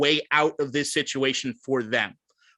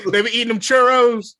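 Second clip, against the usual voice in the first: lightly hammed-up impression, higher and louder with laughter; punchy mock big-man bit, pitched up; Charles impression; 7 semitones higher, 6 dB louder than usual. Friend riffing on San Antonio women, laughing impression. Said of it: "All right, Charles".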